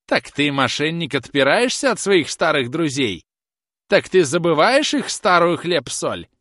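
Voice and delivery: put-on high, loud scolding old woman; shouted tirade. A narrator's reading. Old woman reproaches ungrateful groom, furious shouting. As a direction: rail at him, question after question. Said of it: "закричала старуха".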